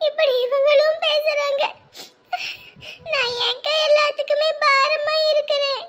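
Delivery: high, crying voice; girl asking her elder sister why everyone treats her as a burden, tearful. Acted. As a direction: plead through tears. Girl weeps that she's a burden.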